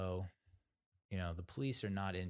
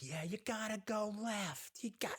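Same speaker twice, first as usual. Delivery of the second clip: emphatic; 14 semitones higher than usual. Retold or imitated. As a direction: insist at length in a high put-on voice. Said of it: "they're also very emphatic".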